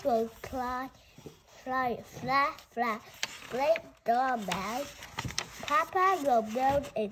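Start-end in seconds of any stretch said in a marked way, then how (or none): none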